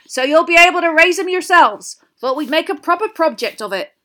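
loud, high, enthusiastic gamekeeper voice; Hagrid, proud. A narrator's reading. Hagrid offering the class a project, loudly proud. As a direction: present it with booming pride. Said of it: "said Hagrid proudly".

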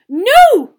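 high, very loud cry; horrified cry. A narrator's reading. Professor rushing to rescue a student, crying out. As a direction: cry out in alarm. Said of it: "cried Professor McGonagall".

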